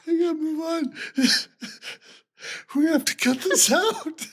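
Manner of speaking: high-pitched words squeezed through laughter; helpless laughter. Real, not acted. Host begs to move on, cracking up laughing. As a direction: try to carry on while cracking up. Trying to recover from laughing fit.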